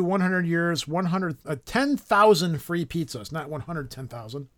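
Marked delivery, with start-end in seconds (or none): 3.3-4.5 quiet self-correction. Real, not acted.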